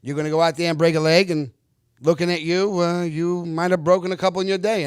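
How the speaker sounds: loud imitation of gruff praise. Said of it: "And he goes".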